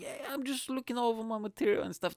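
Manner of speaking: high, airy fake-fine voice; feigned nonchalance. Comedian pretending to read, high fake-casual excuse.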